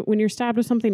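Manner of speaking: normal talking voice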